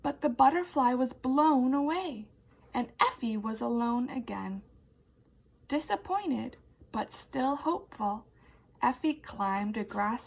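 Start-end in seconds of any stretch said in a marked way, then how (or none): none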